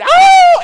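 sudden high, loud howl; dog howl. Acted. Somebody starts it for the others, howling. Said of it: "they're howling".

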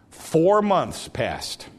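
slow, weighty four months passed; slow emphasis. Shown as a demonstration. Preacher reminding class of timeline, slow and weighty. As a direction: let each word land. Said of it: "we talked about this".

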